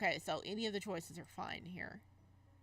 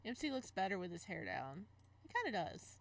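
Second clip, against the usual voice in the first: measured romance read-aloud; story narration. Acted. Gamer reading a romance game's text aloud, narration. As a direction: narrate the guilt, then confront him. Reads visual novel aloud.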